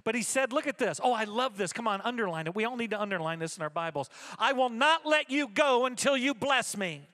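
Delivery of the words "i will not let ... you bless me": loud, slow, raised scripture reading; emphatic declamation. Acted. Jacob refusing to let the Lord go, loud and determined. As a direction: hammer out each word with resolve.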